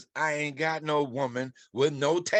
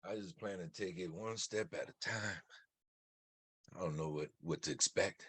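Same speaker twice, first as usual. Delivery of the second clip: quiet; 8 semitones lower, 14 dB quieter than usual. Acted. Intimate talk with Lena.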